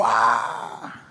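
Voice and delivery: ordinary talk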